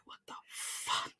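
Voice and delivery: slow, drawn-out whisper of disbelief; hushed, acted shock; stunned whisper. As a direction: whisper it as if floored by the news. Acted stunned reaction to twin news.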